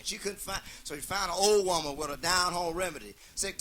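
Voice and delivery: usual speaking voice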